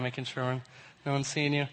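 ordinary talk, nothing marked

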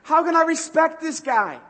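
exasperated wife. Retold or imitated. Wife regretting the marriage, exasperated and loud. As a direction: complain to the heavens about him. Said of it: "And you'd be like".

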